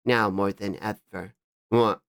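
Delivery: low, slow artist character; slow it down and drop into the character's low voice. Acted. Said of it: "I think that's their voice".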